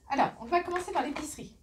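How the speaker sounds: fast, still high restart; brisk second go at the intro; quick restart. Real, not acted. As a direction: rattle off the start again, quickly. Restarts the opening, faster.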